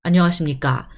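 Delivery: stiff formal self-introduction; measured, bookish Korean announcement style; announcing. Shown as a demonstration. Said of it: "it sounds like you're announcing something".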